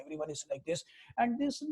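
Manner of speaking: ordinary delivery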